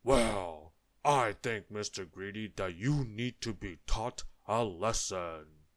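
loud, ponderous giant voice; bellowing giant. A narrator's reading. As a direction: boom out a stern verdict. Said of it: "he bellowed".

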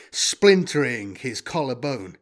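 usual speaking voice

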